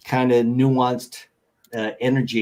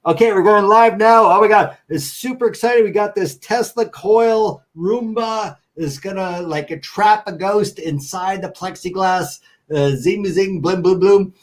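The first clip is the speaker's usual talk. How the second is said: high, loud fake-excited TV host hype; mock hype; 7 semitones higher, 3 dB louder than usual. Hosts announcing the ghost-trapping Roomba live on air, mock hype. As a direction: pump it up like a cheesy ghost-show host going live.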